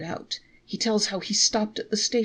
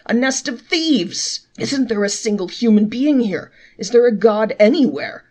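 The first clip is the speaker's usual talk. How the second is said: loud yell; 3 semitones higher, 9 dB louder than usual. A narrator's reading. Traveler yells at the total on the bill, very loud. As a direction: wail it like a man robbed. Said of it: "he let out a yell louder than before".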